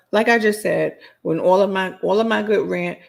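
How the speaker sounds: ordinary talk, nothing marked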